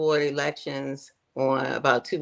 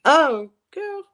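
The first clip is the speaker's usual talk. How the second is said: excited; 6 semitones higher, 5 dB louder, faster than usual. Real, not acted. Laughing self-mockery.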